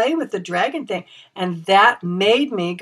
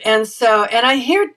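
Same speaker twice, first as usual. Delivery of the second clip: ordinary talk, nothing marked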